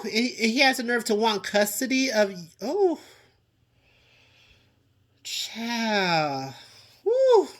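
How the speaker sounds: fired-up rant at the absent ex; getting heated. Real, not acted. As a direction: let the outrage climb as you go. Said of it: "'cause it's getting heated".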